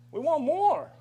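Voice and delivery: firm demand. Real, not acted. Demanding more housing.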